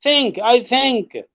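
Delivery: I think, the th said through the teeth; native think. Shown as a demonstration. Teacher demonstrating the tongue-out think to the student. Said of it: "जबान थोड़ी सी बाहर निकाल के".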